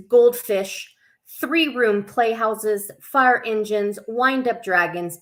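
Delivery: put-on children's story reading. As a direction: read it out to little ones, bright and sing-song. Reads picture book to kids.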